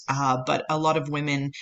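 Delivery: ordinary talk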